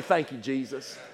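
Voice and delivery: low, soft prayer of thanks; softly spoken gratitude; quiet thanks. Acted. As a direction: drop the voice for a private thank-you. Models thanks on waking, first take.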